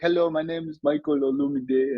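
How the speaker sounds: imitated Nigerian accent, high and careful; Nigerian new kid accent. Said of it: "Probably had to ask, like".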